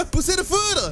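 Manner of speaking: high excited shouted attack call; hyped attack shout. Real, not acted. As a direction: shout the move, thrilled. Yelling out an attack name.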